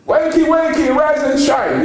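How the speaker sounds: high, loud bandleader's wake-up shout; radio wake-up call. Retold or imitated. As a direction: call it out like the radio host rousing the nation on a Sunday morning. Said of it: "the [name] Band show".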